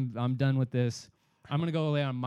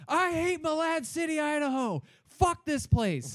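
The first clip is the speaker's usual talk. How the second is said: imitated screaming; 12 semitones higher than usual. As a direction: scream it to the whole town, hammed up. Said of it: "he starts screaming".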